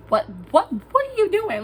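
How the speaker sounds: high-pitched incredulous retelling; alarmed brother. Retold or imitated. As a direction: act out a brother's shocked stammer. Brother's alarmed reaction, acted.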